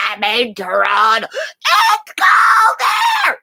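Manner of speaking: high, loud wail; mock complaining tourist. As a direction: wail it slowly like a shocked tourist. Mocking tourist complaining of cold.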